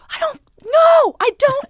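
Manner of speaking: high, loud, slow exasperated cry; freaked-out outburst. Retold or imitated. Retelling freaking out at a third question, loud high outburst. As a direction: lose it, mock frantic. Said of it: "I freaked out".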